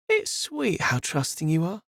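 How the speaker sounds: lightly voiced condescending villainess; dripping condescension. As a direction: talk down to him sweetly. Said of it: "voice dripped with condescension".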